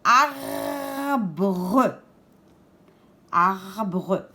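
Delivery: "Arbre" drawn out, both Rs held; emphasized R sounds. Shown as a demonstration. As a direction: sound out "Arbre" slowly so each R is heard. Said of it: "j'entends le R?". (« Arbre » étiré en insistant sur les deux R)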